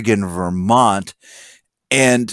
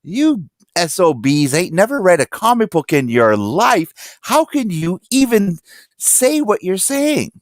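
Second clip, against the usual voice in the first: exasperated comic nerd; 7 semitones higher than usual. Retold or imitated. Ranting at fair weather fans in the diner, hammed-up outrage. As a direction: let the disbelief climb up into a squeak. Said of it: "And I would sit there and go".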